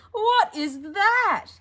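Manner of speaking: high, loud, slow mocking laugh-cry; mocking brother. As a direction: jeer at the drawing through laughter.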